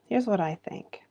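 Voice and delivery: softly whispered, leaning in to share a secret; confiding whisper; whispered aside. Real, not acted. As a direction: drop to a whisper for the key point. Confiding her view to viewers.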